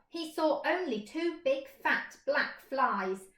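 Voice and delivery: ordinary delivery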